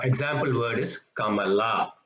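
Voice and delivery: normal talking voice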